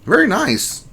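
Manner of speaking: high-pitched cheer; playful congratulations. Pretend. Streamer cheering a viewer's new job, playful high voice. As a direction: lift the voice way up to congratulate.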